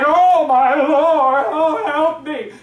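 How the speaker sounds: slow, drawn-out fake despair; hammed-up oh my Lord, help me; mock panicked plea. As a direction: ham up a frightened prayer. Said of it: "you're like".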